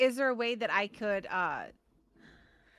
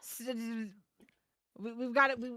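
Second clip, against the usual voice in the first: higher, urgent firm talking-to; intense pep talk; 5 semitones higher, faster than usual. Acted. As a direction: grab him and make him listen. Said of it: "I'm like".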